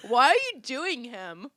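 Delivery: puzzled and loud; confused questioner. Retold or imitated. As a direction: ask it baffled. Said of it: "people would be like".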